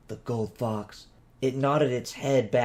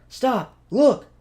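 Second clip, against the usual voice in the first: high, loud sudden cry to stop; sharp alarm; 12 semitones higher, 8 dB louder than usual. A narrator's reading. Viet alerting the group to the fox, loud alarm. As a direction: shout the warning, urgent. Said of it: "He pointed north".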